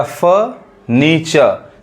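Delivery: फर्नीचर with silent r's; taught pronunciation. Shown as a demonstration. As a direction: say फर्नीचर as 'fuh-NEE-chuh'. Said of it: "उसी तरह". (फर्नीचर को बिना 'र' के 'फ़नीचा' बोलें)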